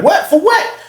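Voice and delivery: high, bewildered question; startled and disbelieving; incredulous protest. Retold or imitated. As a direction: demand a reason, pitch shooting up. Protesting being handcuffed.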